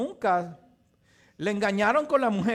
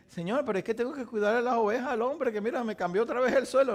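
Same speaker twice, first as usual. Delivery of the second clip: higher, quick excuses to the Lord; protesting Jacob; 6 semitones higher, faster than usual. Retold or imitated. Jacob protesting to the Lord that he must tend the sheep, quick and high. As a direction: rush out a worried excuse. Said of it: "Y él".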